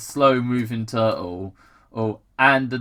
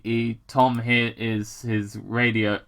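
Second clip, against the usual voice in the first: ordinary delivery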